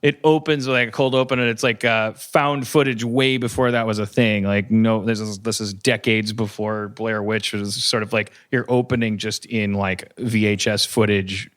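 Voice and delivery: ordinary delivery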